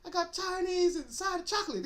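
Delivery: very high comic holler; hammed-up pleading imitation; Tracy. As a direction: call through a locked door in a high voice. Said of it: "he's back at the house pounding on the door".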